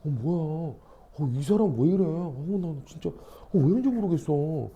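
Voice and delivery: quiet mock bewilderment, seeking agreement; muttered disbelief. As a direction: imitate a bystander grumbling under the breath. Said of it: "주변을 둘러본다니까".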